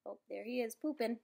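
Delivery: ordinary delivery, nothing marked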